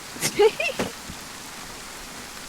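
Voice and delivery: laughing. Real, not acted. Laughs at own gun trouble.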